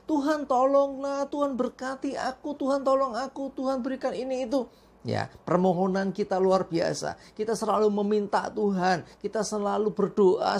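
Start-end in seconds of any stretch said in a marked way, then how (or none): 0.1-4.7 higher, rapid-fire mock begging; mock demanding prayers. Pastor mocking selfish prayers to the congregation, higher mock begging. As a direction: parody a greedy, demanding prayer. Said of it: "Bahkan seringkali apa?".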